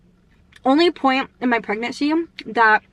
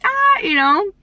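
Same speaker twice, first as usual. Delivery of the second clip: short high "Ah", louder; mock movie scream; 11 semitones higher, 4 dB louder, slower than usual. Vlogger mimicking everyone in labor movies, mock scream. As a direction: imitate a birth scream from the movies.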